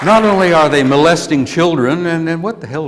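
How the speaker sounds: furious rant at Congress; real anger. Real, not acted. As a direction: let the anger boil over. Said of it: "I'm getting angry".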